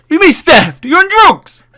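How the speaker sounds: very high, loud incredulous squawk; mock outrage. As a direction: explode in comic disbelief. Acting outrage at theft accusation.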